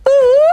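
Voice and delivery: high, loud mimicked "ooh"; a piercing high hoot from behind; imitated attention-getting noise. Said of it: "I just heard this noise".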